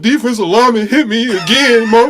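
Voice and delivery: high, loud hammed-up wail; mock sob shouted high to mama. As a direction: wail like a crying player telling his mom. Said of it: "That's what he looked like".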